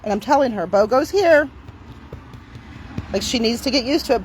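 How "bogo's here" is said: higher, louder, cheery; upbeat announcement. Real, not acted. Telling Timber that Bogo is here, cheerful and high. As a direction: announce it like good news.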